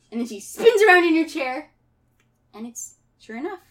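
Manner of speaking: ordinary delivery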